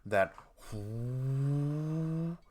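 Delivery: rising imitated generator hum; generator whoo. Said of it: "as if you've ever heard an electrical generator".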